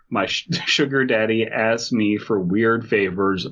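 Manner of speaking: ordinary talk, nothing marked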